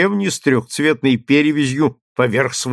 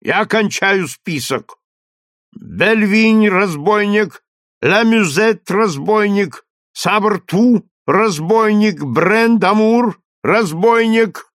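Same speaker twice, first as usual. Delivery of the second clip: slow, loud and higher, like a trumpet; booming crier; 8 semitones higher, 4 dB louder, slower than usual. A narrator's reading. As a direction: boom each word out like a trumpet. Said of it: "крикнул глашатай".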